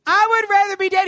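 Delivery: high, loud, fed-up plea; desperate outburst. Acted. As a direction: cry out that you've had enough. Acted desperate plea of Moses.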